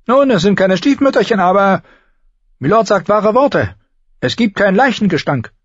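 put-on very high old woodsman's voice; high-pitched, grumpy old man. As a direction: report it as a crusty old forester. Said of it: "Düven, der mürrische alte Waldmann".

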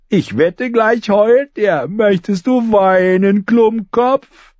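high, slow, drawn-out teasing; taunting boy. Acted. Lommi taunting Arya to tears, high and slow. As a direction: drag it out to make her cry.

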